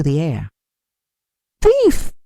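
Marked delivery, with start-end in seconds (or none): 1.6-2.1 much higher, loud accusing yell; angry shout. A narrator's reading. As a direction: hurl the word at a thief. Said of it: "they shouted".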